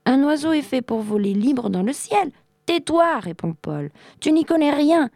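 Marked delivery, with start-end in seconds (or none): 0.0-2.3 s'écrie. A narrator's reading. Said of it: "Arthur s'écrie". 2.7-3.2 ordre sec crié très haut et fort; furieux. A narrator's reading. Paul coupe Arthur en colère. 4.2-5.1 crié haut et fort, cinglant; écrase le garçon de mépris; méprisant et furieux. A narrator's reading. Paul rabroue Arthur.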